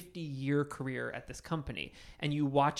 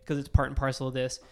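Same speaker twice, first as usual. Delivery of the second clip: ordinary delivery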